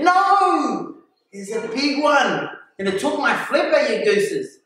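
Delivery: high, drawn-out insistence with a long no; retold indignant protest. Diver telling friends of the lost flipper, high indignant protest. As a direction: argue back high and exasperated.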